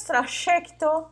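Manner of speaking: Argentine "trajecto" with the y as sh, slow; Argentine accent. Retold or imitated. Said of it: "como dizem os argentinos".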